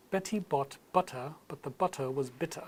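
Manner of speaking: British accent with clear T's in each word. Shown as a demonstration. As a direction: put on a British accent, crisp T's, dropped R's. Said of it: "British accent with clear T's".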